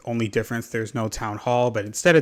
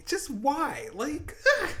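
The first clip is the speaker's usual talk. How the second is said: half laughing, half wailing; high laugh-cry of despair; laughing exasperation; slower than usual. Real, not acted. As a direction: laugh through the outrage.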